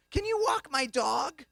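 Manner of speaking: high, slow questioning voice; imitated date. Date asking a favour on meeting, high slow mimicry.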